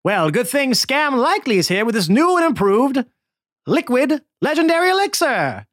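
slick salesman. Acted. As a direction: call out like a carnival pitchman. Scam Likely pitching elixir.

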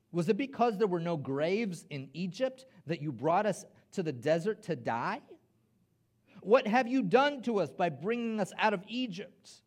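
emphatic, accusing questions; complaining. Acted. Israelites confronting Moses in the desert, complaining. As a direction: read it as a pointed grievance. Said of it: "Here's their complaining".

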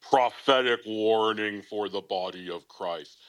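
slow, declaimed prophetic pronouncement; mock-solemn doom announcement; imagined prophetess. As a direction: proclaim it like a portentous prophetess. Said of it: "I imagine she sounds kinda like that".

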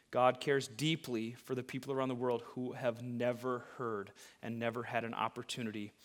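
quiet, low, sincere plea; soft earnest appeal. Acted. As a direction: speak it softly to the committed.

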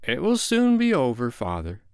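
put-on high, cheery boy's voice. A narrator's reading. John reassuring his father, cheerily. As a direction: cheer him along, pitched high then dropping. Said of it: "John said cheerily".

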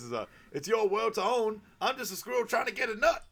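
high, loud over-the-top stage delivery; manic comic act. Retold or imitated. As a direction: belt it out like a comic losing his mind. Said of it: "Just loses his mind".

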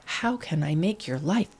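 soft unvoiced whisper, eager to please; husband whispering devotion, unvoiced; whispered doting. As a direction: breathe it like a sweet secret. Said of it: "This guy was like".

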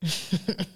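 helpless giggle; cracking up; breathless laugh. Real, not acted. Cracking up over the boots.

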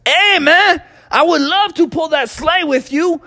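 high, eager cartoon dinosaur voice; Iggy the Iguanodon. Iguanodon agrees to pull the sleigh, high eager voice. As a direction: pitch it up, keen and friendly. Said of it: "and Iggy was like".

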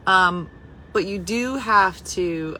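usual speaking voice

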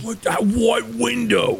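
puzzled demon. Acted. GM voices demon Reginald.

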